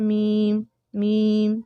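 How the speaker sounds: میم with the sound pushed into the nose; nasal meem. Shown as a demonstration. Teacher demonstrating the nasal میم mistake. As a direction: say میم nasally as the wrong example. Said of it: "تو یہ ناک میں آواز جا رہی ہے".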